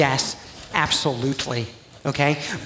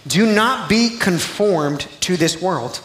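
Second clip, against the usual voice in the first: slow, deliberate reading of scripture; 5 semitones higher, 4 dB louder, much slower than usual. Acted. Pastor reading Romans to congregation, slow and deliberate. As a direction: weigh every word. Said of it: "Romans twelve two".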